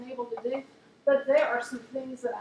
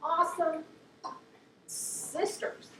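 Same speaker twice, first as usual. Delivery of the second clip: choking up; 4 semitones higher, slower than usual. Real, not acted. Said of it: "I cry with my clients".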